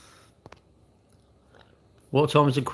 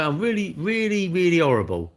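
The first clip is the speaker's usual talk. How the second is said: mock troll; 6 semitones higher than usual. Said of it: "you can say".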